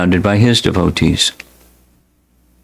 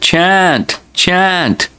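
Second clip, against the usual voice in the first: high-pitched call, falling at the end; exclaimed command; 9 semitones higher than usual. A narrator's reading. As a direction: call out each word high, urging them on. Narrator voices the Lord's command.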